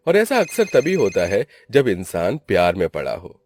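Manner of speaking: ordinary delivery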